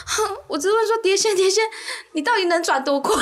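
high, whimpering sobbing confession; mock crying. The fourth player confessing the silly question, mock sobbing. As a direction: whine it out through fake sobs.

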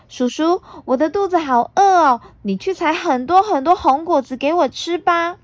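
put-on high, bright little girl. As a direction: chatter like a cheeky young girl. Puna asks for fruit.

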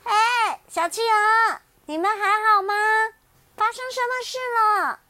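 high, loud call across the ice; calling out loudly. Acted. Little bear calls loudly to the penguins across the ice. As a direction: shout out to the crying penguins. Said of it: "小熊大声地喊".

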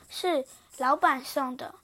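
plain reading voice

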